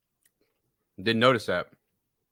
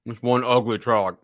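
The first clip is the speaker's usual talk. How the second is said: crunching chips; slower than usual. Real, not acted. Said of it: "Sorry about the crunching".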